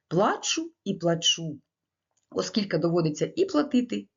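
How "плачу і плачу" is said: slow, "Плачу" with stress on the first syllable, then "плачу" on the second; both stresses shown. Shown as a demonstration. Lecturer demonstrating the two stresses of the word to teachers, slow. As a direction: say "Плачу і плачу" slowly, shifting the stress between the two. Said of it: "наголос в останньому слові назви можна робити і на першому, і на другому складі".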